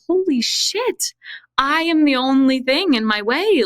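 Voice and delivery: retelling a jaw-dropping moment of clarity; stunned realization. Retold or imitated. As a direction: let the epiphany hit you. Sudden realization retold.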